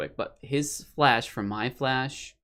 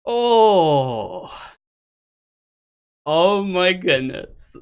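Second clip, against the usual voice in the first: overwhelmed; 6 semitones higher, 7 dB louder, much slower than usual. Real, not acted. Recovering after the win.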